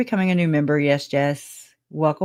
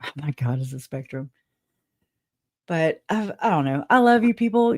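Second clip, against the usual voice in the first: usual speaking voice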